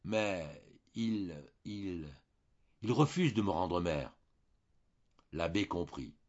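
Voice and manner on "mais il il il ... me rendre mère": halting, embarrassed stammer; flustered wife confesses to the priest. A narrator's reading. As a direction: stumble over the words, ashamed. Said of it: "elle se troubla pour expliquer".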